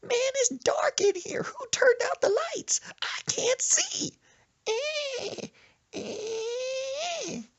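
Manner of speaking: put-on very high, slow whispering old lady; demented sheep voice. Grandma stuck under her robe, very high whimper. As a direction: whimper like a lost sheep in the dark. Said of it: "sounded like a demented sheep".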